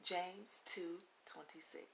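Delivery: usual speaking voice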